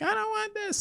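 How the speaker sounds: very high, whiny falsetto; mock whiny wife. Husband imitating his wife rejecting the food. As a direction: whine it like a picky eater. Wife quoted refusing the wings.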